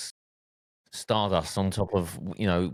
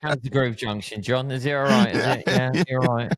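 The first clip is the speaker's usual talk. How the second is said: higher, louder mock phone greeting; mock DJ answering phone; 4 semitones higher, 4 dB louder than usual. DJ mockingly greeting a repeat caller on the phone, higher and louder. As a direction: ham up a cheery fake-friendly DJ. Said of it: "It's not like you can disguise your voice".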